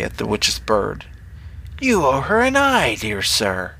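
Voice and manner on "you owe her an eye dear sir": high witch voice, dropping at the end. A narrator's reading. Witch demanding an eye from Brenner, witch voice. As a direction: start high and eerie, sink on the address.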